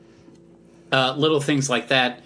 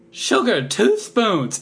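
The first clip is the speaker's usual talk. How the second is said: much higher, louder cartoon voice; March Hare impression; 9 semitones higher, 4 dB louder, much slower than usual. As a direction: bark the order like a manic tea host. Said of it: "and then he goes".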